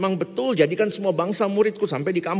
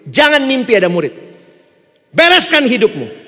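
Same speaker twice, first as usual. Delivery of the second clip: fiery preaching; 3 semitones higher, 9 dB louder than usual. Acted. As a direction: drive the warning home. Preaching at full force.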